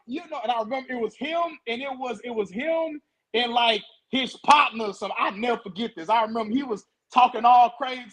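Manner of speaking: usual speaking voice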